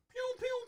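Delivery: high, sharp mock blaster zaps; laser sound effect. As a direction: fire off toy laser shots with your mouth. Imitating laser blasts in chase.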